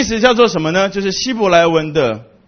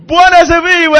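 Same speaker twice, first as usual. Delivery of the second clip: high, loud call to the crowd; 11 semitones higher, 6 dB louder, faster than usual. Retold or imitated. Preacher retelling his call to the African congregation, loud. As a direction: call it out to rouse them. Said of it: "我就说".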